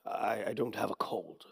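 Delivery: hushed confiding whisper. Acted. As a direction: whisper it to a stranger. Elderly man on shuttle.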